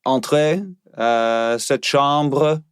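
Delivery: put-on drunk French cop voice; slurred, slow French accent. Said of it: "be a French inebriated police officer".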